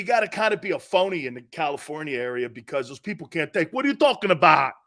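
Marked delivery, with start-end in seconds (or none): 3.7-4.7 a hint of a New York East Coast accent, quick; tossed off in a light East Coast voice. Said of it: "I'm getting my accent back".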